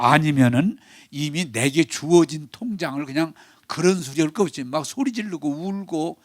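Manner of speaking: usual speaking voice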